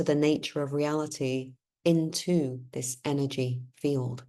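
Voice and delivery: measured, solemn read-aloud; formal reading. Acted. Reads Joseph text aloud.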